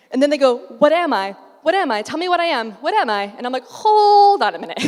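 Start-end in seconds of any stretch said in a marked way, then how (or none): 0.8-3.3 high, loud, insistent mock pestering; mock frantic demanding. Clients begging the fitter for their size, mock frantic. 3.7-4.8 high, loud, comic exclamation; loud halting call. Retold or imitated. Fitter stopping frantic clients, loud comic halt.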